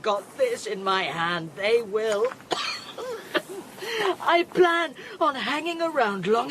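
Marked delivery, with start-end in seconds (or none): 2.4-4.2 harsh sick coughing. Acted. Radiation-sick Beth coughing.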